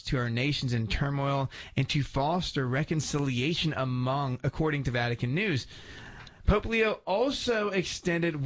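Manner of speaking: steady newscaster delivery; news read. Acted. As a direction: read it like a radio news brief. Reads the news segment.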